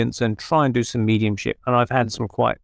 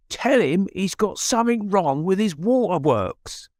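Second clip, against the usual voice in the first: slow, higher Cockney cabbie voice; 5 semitones higher than usual. Retold or imitated. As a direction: spell it out slowly in a London cabbie's Cockney. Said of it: "in a Cockney accent".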